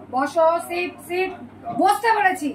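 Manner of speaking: firm command to sit. Real, not acted. Ordering pet to sit. (কুকুরকে বসতে বলা, ধমকে চিৎকার)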